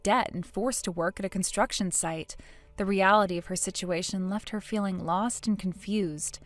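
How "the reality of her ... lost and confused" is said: ordinary delivery, nothing marked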